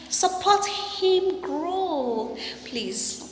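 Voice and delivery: coaxing, drawn-out please; high, slow pleading. Real, not acted. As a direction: stretch the plea out high and slow.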